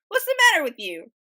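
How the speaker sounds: high, loud joke-character question. Acted. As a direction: demand it as the crocodile in a joke. Crocodile questions lizard in joke.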